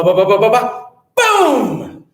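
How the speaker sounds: bumping ba-ba-ba then a high loud boom; noise imitation. Storyteller imitating the noise upstairs, rising to a loud boom. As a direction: mimic footsteps then a crash.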